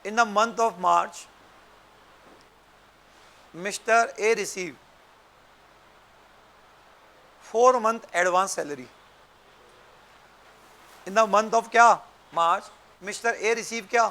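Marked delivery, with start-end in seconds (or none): 0.0-8.9 very slow, spaced-out dictation; dictating. Shown as a demonstration. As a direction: read each phrase slowly for notes. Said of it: "एक question लिख लो बच्चों".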